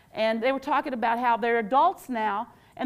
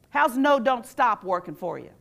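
ordinary talk

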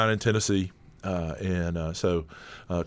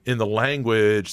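usual speaking voice

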